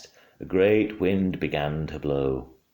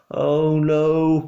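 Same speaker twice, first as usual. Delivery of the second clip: higher, louder cry of dismay; 10 semitones higher, 6 dB louder than usual. A narrator's reading. Boy hearing of worm burgers, loud dismayed cry. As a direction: wail it like a horrified boy. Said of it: "cried Philip".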